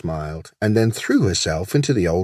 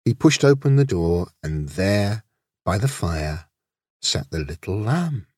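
usual speaking voice

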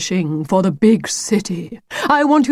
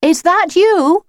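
high, slow questioning call; 11 semitones higher, 4 dB louder, slower than usual. Acted. Lucy calls to whoever follows her, high and drawn-out. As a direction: call out high to someone hidden. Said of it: "Lucy called".

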